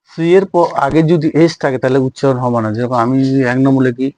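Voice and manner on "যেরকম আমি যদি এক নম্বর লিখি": normal talking voice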